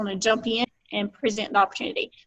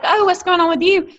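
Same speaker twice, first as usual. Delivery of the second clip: high, louder, chirpy mock greeting; mock friendly greeting; 8 semitones higher, 5 dB louder than usual. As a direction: ask it in a high, fake-bright voice.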